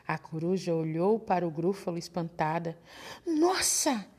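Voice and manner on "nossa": higher, startled owl cry; a quick frightened gasp of a word; startled exclamation. Acted. Owl seeing the gruffalo, startled higher cry.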